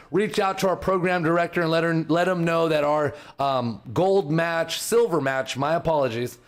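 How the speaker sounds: ordinary talk, nothing marked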